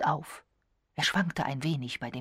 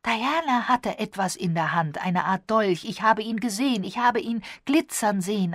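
raised, loud, urgent account; excited insistence; 4 semitones higher, 6 dB louder than usual. A narrator's reading. Violet testifies about the dagger, excited insistence. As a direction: insist eagerly on what you saw.

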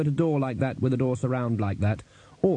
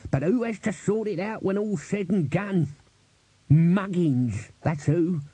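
grouse to yourself like a put-upon retainer; grumbling old servant; 3 semitones higher than usual. Acted. Albert grumbles to himself.

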